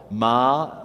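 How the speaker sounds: Ma said alone with an open ah; teacher sounds out the first syllable; single syllable. Shown as a demonstration. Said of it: "the mem with the ah".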